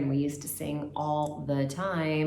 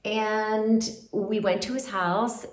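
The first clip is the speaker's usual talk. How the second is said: normal talking voice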